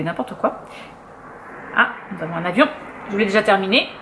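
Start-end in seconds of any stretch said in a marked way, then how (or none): none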